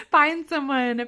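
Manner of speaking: laughing urge. Real, not acted. Host urging listeners to find an advisor, laughing. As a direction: blurt "find someone" through a laugh.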